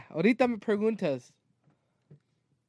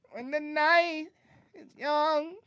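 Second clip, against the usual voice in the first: spooky recital; 4 dB quieter than usual. Pretend. Spooky recital with drum sounds.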